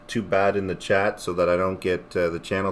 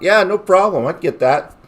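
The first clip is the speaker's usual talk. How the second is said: eager yes; 10 semitones higher, 5 dB louder than usual. Retold or imitated. Eager reply to the request.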